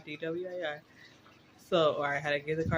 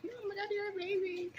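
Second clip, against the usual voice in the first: high, gushing mock coo at a baby; gushing friends; 5 dB quieter than usual. Friends hearing she's twenty-seven, high mock gush. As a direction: imitate people fussing over how young she is. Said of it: "they're like".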